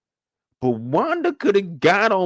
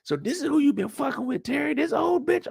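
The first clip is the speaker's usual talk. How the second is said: rising high, contemptuous voice; high sneer; 8 semitones higher than usual. Retold or imitated. Imitating her scorn at Terry, high and sneering. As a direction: climb up in disdain. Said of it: "She's like".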